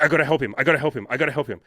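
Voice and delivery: urgent repeated self-talk, loud at first; anxious, restless. Retold or imitated. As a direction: imitate restless eagerness, tapering off. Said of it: "like, anxious, you know, un-, unresting energy".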